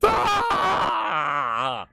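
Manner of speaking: piercing squeal; startled screech; high shriek. Real, not acted. Screaming at trailer.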